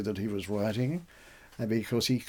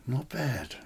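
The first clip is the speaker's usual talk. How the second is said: softer, quiet mock approval from an old poet; a quiet imagined nod of approval; imagined approving poet; 7 dB quieter than usual. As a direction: murmur it like a pleased master. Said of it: "I could hear the Gawain poet saying to me".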